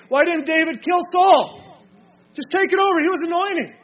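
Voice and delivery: high, loud, insistent questioning; urgent challenge. Acted. Preacher argues why David spared Saul, urgent challenge. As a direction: push the objection hard, pitched well up.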